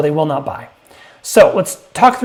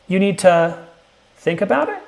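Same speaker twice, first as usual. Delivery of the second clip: rising pitch at the end, a real question; 3 semitones higher than usual. Shown as a demonstration. Salesperson echoing the prospect's smoke screen, questioning rise. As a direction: echo it back as a question, lifting high at "it". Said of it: "repeat the smoke screen in a questioning tone".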